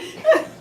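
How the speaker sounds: sudden high laugh; high burst of laughter. Real, not acted. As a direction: crack up loud.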